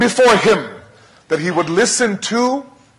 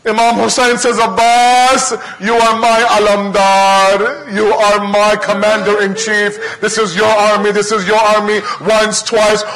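impassioned preaching; 5 semitones higher than usual. Acted. Preacher narrating Abbas and Zainab to the mourners, impassioned. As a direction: preach the climax with full force.

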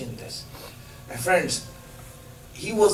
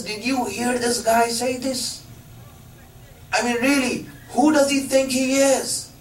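high-pitched, incredulous retold anger; indignant soldier; 7 semitones higher than usual. Frustrated soldier to his companion about Imam Ali, angry disbelief. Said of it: "he was so angry".

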